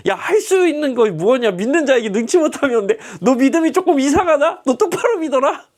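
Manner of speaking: mock-scold the doubting father; scolding Jesus. (믿음 없는 아버지를 높고 크게 꾸짖는 예수 흉내)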